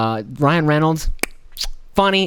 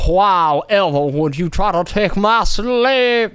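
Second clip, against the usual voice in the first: slow, higher drawling plantation-owner voice; drawled Southern aristocrat; 6 semitones higher, much slower than usual. Retold or imitated. Vlogger acting a drawling Southern plantation owner, slow and higher. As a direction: draw it out, scandalised Southern gentry. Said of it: "draw out a little bit more".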